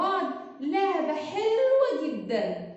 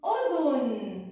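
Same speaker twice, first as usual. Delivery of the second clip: slow model word; 2 semitones lower, much slower than usual. Shown as a demonstration. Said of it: "تاني".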